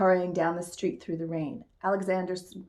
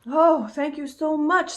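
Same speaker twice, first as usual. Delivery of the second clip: grateful; 8 semitones higher, 5 dB louder, slower than usual. A narrator's reading. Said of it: "said Alexander".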